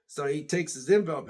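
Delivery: ordinary delivery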